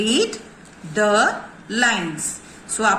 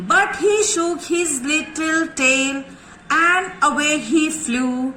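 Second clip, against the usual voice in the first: slow poem recitation; 3 semitones higher, much slower than usual. Shown as a demonstration. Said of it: "चलिए revision शुरू करें।".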